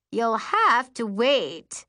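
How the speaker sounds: whiny nasal voice, slow. Acted. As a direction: pinch it through the nose. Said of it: "in an extra nasal voice".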